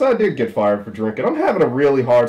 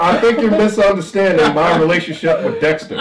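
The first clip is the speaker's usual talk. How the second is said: nervous laughing denial; 8 semitones higher, 6 dB louder than usual. Acted. Flustered suspect denies with laughter.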